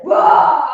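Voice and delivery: high loud whoa; mock monkey whoa. Hanuman carrying rocks, a loud high whoa. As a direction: shout whoa like a straining monkey. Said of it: "was like".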